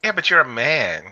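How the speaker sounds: normal talking voice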